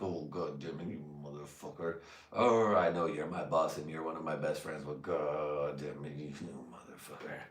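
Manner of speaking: low, soft grumbling curse under the breath; muttering curses at his boss; muttered curse. Retold or imitated. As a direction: curse him quietly under your breath.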